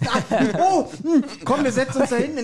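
high, fast, laughing play-acting; hammed-up fussing voice. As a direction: rush the words, laughing at the bit. Continuing the hot-punch scene.

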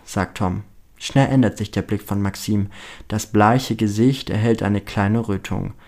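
ordinary delivery, nothing marked

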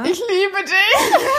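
high, slow, dreamy love line breaking into laughter; giggling fake romance, very high and drawn out; mock swooning. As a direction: tease her by hamming up a slow, high love confession. Listener jokingly voices the hoped-for line.

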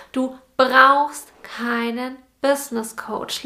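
slow, with "brauchst" leaned on hard; stress on brauchst. Shown as a demonstration. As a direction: draw out "brauchst" and let the sentence fall after it. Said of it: "die Betonung hierbei liegt auf brauchen".

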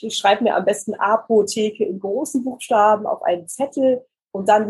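ordinary delivery

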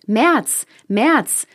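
high, loud März, März; calling after him. Acted. April calling March back, loud call. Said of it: "rief der vierte ihm nach".

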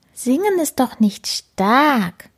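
high, slow letdown whine; a small kitten let down; disappointed kitten. A narrator's reading. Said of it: "sagt Karlchen enttäuscht". (enttäuscht, langsam, hoch)

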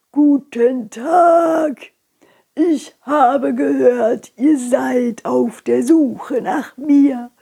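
put-on slow, croaky old fish speaking with care; an ancient fish greeting the visitors slowly. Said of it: "krächzende Stimme".

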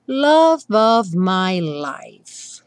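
slow, love of linked with a V sound. Shown as a demonstration. Teacher reads the song line, linking love of. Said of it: "revisando of, no caso com som de V".

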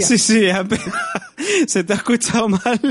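laughing through the words; giggling while talking. Real, not acted. Laughing at garbled oracle voice.

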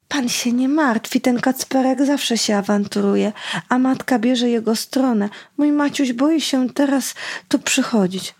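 ordinary delivery

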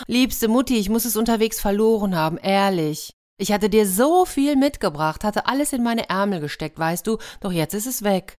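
hammed-up crying excuse; whining. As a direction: whimper out a lie, hamming up the tears. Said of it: "Wind hingegen heulte".

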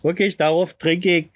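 ordinary talk, nothing marked

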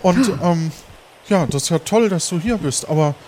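put-on glad and worried old friend; Sören's voice.

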